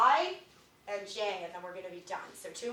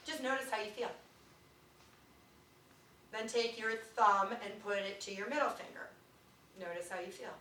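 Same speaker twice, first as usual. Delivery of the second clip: soft, quiet meditation voice; guided meditation; 5 dB quieter than usual. Acted. Teacher guiding the class through finger touches. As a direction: guide them softly through each finger.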